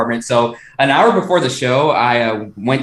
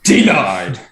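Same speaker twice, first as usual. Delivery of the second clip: mock denial; 3 dB louder than usual.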